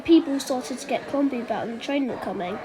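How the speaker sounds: plain reading voice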